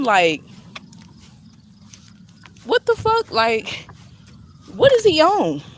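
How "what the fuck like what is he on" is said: high, loud incredulous outburst; shocked disbelief. Retold or imitated. Reacting to the maintenance guy's weird behavior, stunned and loud. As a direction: pitch jumps way up in stunned disbelief.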